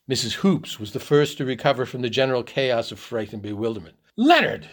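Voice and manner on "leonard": high, loud cry of the name; shrill scream. A narrator's reading. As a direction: scream shrilly for your nephew, slightly restrained. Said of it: "she screamed shrilly to her nephew".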